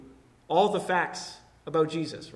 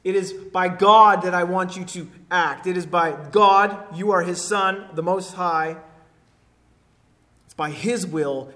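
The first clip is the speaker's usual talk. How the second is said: louder preaching, pressing each phrase; emphatic preaching; 8 dB louder than usual. Acted. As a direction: lean into the appeal for the congregation. Preacher paraphrases demon's appeal.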